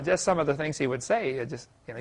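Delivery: ordinary delivery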